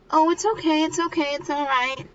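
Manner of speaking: high, repeated brushing-off; lightly acted reassurance. Mika telling her friend it is fine, high mock reassurance. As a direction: imitate a friend hurriedly waving away an apology. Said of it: "Miko's like".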